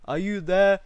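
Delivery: hopeful shout into the clover; calling out. A narrator's reading. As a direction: call out to someone you can't find. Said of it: "and called".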